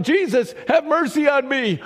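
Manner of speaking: loud, high plea for mercy; crying out. Retold or imitated. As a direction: cry it over the crowd. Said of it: "And he cries out".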